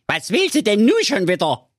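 very high, slangy creature voice; cheeky talking bomb. A narrator's reading. Talking bomb greeting the digger driver, very high cheeky voice. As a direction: greet him like a cocky youngster.

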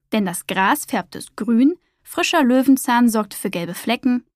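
ordinary delivery, nothing marked

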